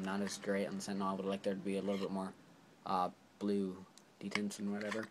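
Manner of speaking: normal talking voice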